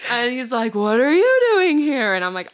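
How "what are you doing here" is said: mock-startled question, high and loud; startled retold question. Daughter imitating dad bumping into her in store, startled. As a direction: imitate a parent caught off guard.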